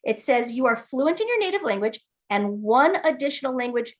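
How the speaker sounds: ordinary talk, nothing marked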